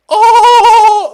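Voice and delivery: very high, loud held cartoon wail; High Five Ghost. Shown as a demonstration. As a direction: hold one piercing, high note in a cartoon voice. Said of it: "Can you do high five, Ghost?".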